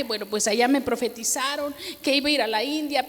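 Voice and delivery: normal talking voice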